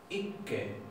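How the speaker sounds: a light native-like ikke with a doubled k; Norwegian ikke. Shown as a demonstration. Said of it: "esto se pronuncia ikke".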